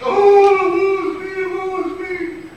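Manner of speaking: hammed-up lament, high then fading; hammed-up distraught wail. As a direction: play the tragic girl, overdone. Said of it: "Ah, she's so distraught".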